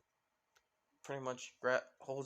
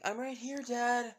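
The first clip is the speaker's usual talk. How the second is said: high, raised call back to dad; yelling out; 10 semitones higher, 5 dB louder than usual. Acted. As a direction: yell back so dad can find you. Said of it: "With Melissa yelling out saying".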